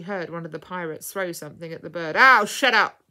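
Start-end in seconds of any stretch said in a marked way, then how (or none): 2.1-3.0 loud, irritated pirate yell; gruff shouted outburst. A narrator's reading. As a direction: holler at the bird to be silent. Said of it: "he yelled".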